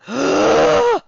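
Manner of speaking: sharp delighted gasp, high and loud. Acted. Player spots Guts joining, high excited gasp. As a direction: gasp in mock surprise.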